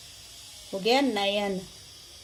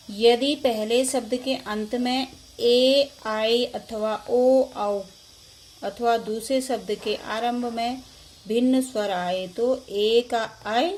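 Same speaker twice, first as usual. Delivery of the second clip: dictating slowly; 4 dB louder, slower than usual. Shown as a demonstration. Teacher dictates rule slowly.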